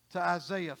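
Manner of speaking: ordinary talk, nothing marked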